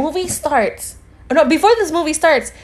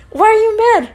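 higher, pressing repeat of the question; mock nagging insistence; 7 semitones higher, 4 dB louder, faster than usual. Date badgering her in the car, insistent. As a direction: imitate him pestering, voice pushed up.

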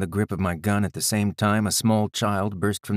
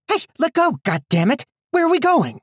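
put-on panicked girl yelling; 6 dB louder than usual. Daughter protesting being dragged.

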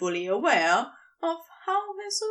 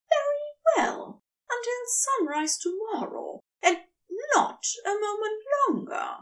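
higher angel voice, bargaining firmly; a prim angel laying down terms; high, earnest voice holding the line; 9 semitones higher than usual. A narrator's reading. Aziraphale setting terms.

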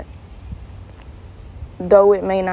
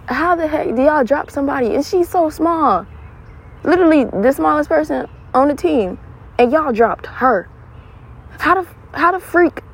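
indignant rant; 7 semitones higher than usual. Real, not acted. Ranting about friend being dropped.

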